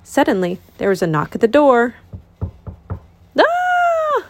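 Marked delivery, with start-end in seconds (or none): 3.4-4.2 high scream. Acted. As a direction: shriek in sudden fright. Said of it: "They all screamed".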